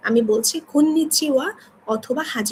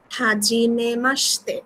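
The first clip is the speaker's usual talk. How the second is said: Hajime মাসতে said slowly, syllable by syllable; teacher sounding out Hajime মাসতে slowly for the class; slow model phrase; much slower than usual. Shown as a demonstration. Teacher models a Japanese greeting slowly for students. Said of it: "আপনারা এটা একটু খেয়াল করবেন".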